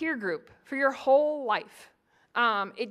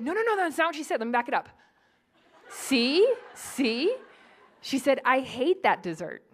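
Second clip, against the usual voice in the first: insistent, a bit louder; playful indignant replay; mock replay argument; 2 semitones higher, 3 dB louder than usual. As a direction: prove your point off the tape. Rewinding dinner-party video to prove.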